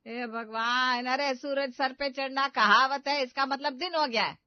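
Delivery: loud and fed up, stretch the opening; mother-in-law losing patience; exasperated. Acted.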